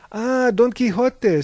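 high, loud, drawn-out exclamation; delighted recognition. A narrator's reading. Mahogany recognizes the book hero Harvey names, delighted recognition. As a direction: exclaim the name slowly and grandly.

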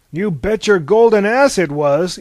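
brash, punchy comeback; heated interjection. A narrator's reading. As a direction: cut in forcefully. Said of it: "interjected Aphrodite".

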